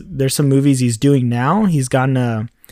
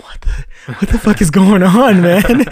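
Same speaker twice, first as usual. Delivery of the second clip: higher and louder, what-the-hell astonishment; stunned disbelief; 7 semitones higher, 5 dB louder than usual. Retold or imitated. Crew on the salt flats watching strange cars roll in, stunned and loud. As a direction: blurt it out, caught completely off guard.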